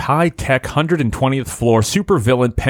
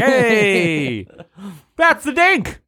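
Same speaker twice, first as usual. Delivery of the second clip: high, gleeful creep call; creepy catchphrase; 8 semitones higher, much slower than usual. Acted. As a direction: shout it like a catchphrase. Callback catchphrase shout.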